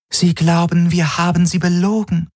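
cold and distant, not human; shadow alien voice. A narrator's reading. The Shadow Helix warns the crew they are believed liars.

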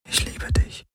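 soft unvoiced whisper of love. A narrator's reading. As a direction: breathe it into her ear. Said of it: "flüsterte er ihr ins Ohr".

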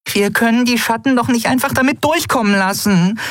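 very high-pitched, exasperated plea; high, frantic character protest; desperate small companion. A narrator's reading. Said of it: "Nelen zerrte verzweifelt".

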